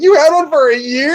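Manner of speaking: imitating the daughter's shocked question; hammed-up astonishment, voice jumping high; mock disbelief.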